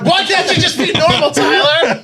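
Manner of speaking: high laughing shout. Real, not acted. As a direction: yell it while cracking up. Laughing at Tyler.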